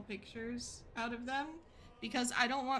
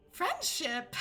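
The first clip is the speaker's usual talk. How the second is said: higher, louder teasing "Friendship?"; mock offended; 5 semitones higher, 3 dB louder than usual. Aliona teases about calling it a friendship, mock indignant. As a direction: throw the word back teasingly. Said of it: "And Aliona goes".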